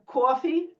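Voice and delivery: New York coffee with a long rounded aw vowel; New York accent. Shown as a demonstration. Linguist showing the old New York coffee, slowly. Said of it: "say coffee than coffee".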